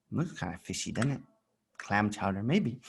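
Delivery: soft, musing; half-whispered wondering; quiet aside. Real, not acted.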